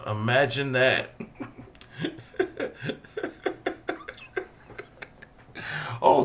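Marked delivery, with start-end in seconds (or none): 1.2-4.4 high, breathy laugh cracking up; laughing at his own joke. Real, not acted.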